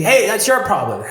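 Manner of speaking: high, loud shouted taunt. Retold or imitated. Shouting his trigger out on the street, loudly. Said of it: "I'm gonna go louder".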